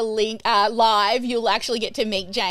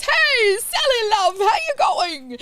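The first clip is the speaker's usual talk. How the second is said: much higher, loud cheery shopkeeper hello; shop owner greeting; 10 semitones higher, 5 dB louder, slower than usual. Retold or imitated. Chip shop owner greeting a regular, high and loud. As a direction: call out a big friendly welcome across the counter. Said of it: "Alex goes".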